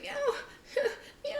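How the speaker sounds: very high, soft little meow; small squeaky cat voice. Acted. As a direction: imitate a cat with tiny high mews. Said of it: "he's like".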